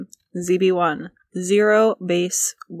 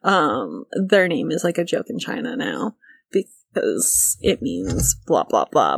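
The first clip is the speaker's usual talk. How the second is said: low mocking voice; 8 semitones lower than usual. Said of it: "People being like".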